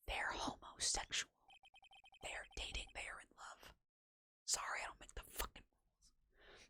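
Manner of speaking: hushed confidential whisper; whispered aside. Pretend. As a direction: whisper it as if sharing a secret. Whispered aside between game lines.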